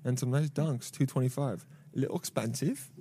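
soft, absent-minded undertone; thinking aloud. Real, not acted. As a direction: read it softly to yourself, as if unheard. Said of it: "I said that in my head. I didn't say it out loud".

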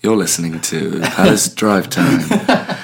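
slow, smooth radio presenter with a laugh. Pretend. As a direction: announce it like a drive-time DJ. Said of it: "It's, it's on purpose".

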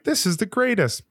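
high-pitched happy exclamation; voicing pure delight at a lucky morning; elated. Driver catching all green lights, elated.

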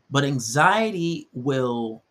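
ordinary talk

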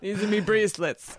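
laughing imitation of an Irish brogue; mock Irish accent through a laugh. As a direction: echo it in a jokey Irish accent. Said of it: "That's a great Irish accent".

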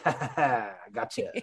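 teasing ha ha ha gotcha; gloating, laughing taunt; mocking laugh. Friend mocks by betraying vote.

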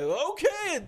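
very high, silly put-on compliance; cartoonish high okay; goofy friend impression.

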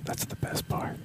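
soft, high ASMR whisper. Pretend. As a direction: whisper close to the mic. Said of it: "Do some A S".

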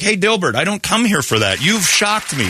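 high-pitched, affronted protest; mock indignation. An upset reader telling off Dilbert, mock indignation. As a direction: act scandalized by a comic strip.

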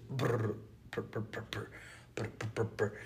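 soft lip-trilled engine putters; imitated sputtering rotor. Pilot tries to restart his rotor, imitated weak sputtering. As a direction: sputter quietly like a rotor failing to catch. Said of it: "trying his rotor back up".

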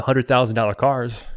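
ordinary delivery

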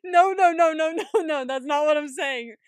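loud, high string of denials; laughing protest. Real, not acted. Co-host correcting a misunderstanding about Ben, loud laughing protest. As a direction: rush to correct a misunderstanding, amused.

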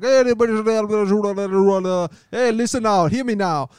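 high, loud dancehall hype voice; [name] impression. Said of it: "He was like".

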